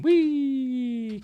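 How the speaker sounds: long high "whee"; mock joyful whee. Narrator mimicking climbers jumping off their mountain, mock joyful whee. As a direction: squeal a drawn-out whee for the jump.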